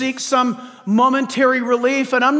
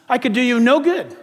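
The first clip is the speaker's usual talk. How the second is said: normal talking voice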